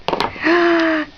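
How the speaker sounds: gasp, then high stretched praise; delighted praise. Real, not acted. Cheers toddler after a gasp.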